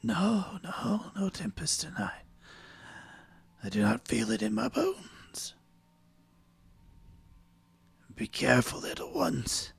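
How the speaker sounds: high, hushed, spooky ghost voice; eerie whispering spirit. Acted. GM voicing ghostly figure.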